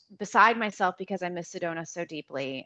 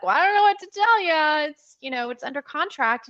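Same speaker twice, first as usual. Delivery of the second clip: high, loud helpless protest; pitched-up mock helplessness; exasperated agent impersonation; 7 semitones higher, 6 dB louder than usual. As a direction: imitate a realtor throwing up objections. Acting the agent brushing her off.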